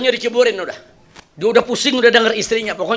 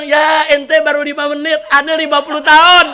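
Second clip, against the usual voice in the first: shouted comeback, higher and louder, with a laugh; yelling it back triumphantly; loud retort; 7 semitones higher, 7 dB louder than usual. Acted. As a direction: fire back at him, half laughing. Husband retorts to the jinn.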